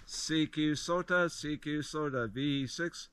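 ordinary talk

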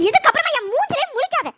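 wife sending her husband away for good, angry; angry dismissal. Acted. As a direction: slam the door on him in words.

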